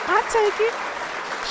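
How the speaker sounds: slow, very high falsetto; mocking squeak. Host quoting Pretty Mimi, squeaky imitation. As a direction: draw it out in a tiny put-on squeak. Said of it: "Shout out to Pretty Mimi that said".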